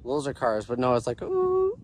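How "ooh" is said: high-pitched imitated ooh; high ooh sound. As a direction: mimic a high eerie ooh. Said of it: "it was a higher pitch".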